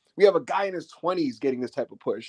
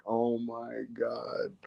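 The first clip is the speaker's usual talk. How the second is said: stunned murmur; 6 semitones lower, 10 dB quieter, much slower than usual. Retold or imitated. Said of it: "Dom is like".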